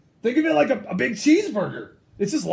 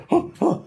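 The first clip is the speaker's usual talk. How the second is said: sneeze intake gasp; 8 semitones higher than usual. Acted. Sneeze coming on.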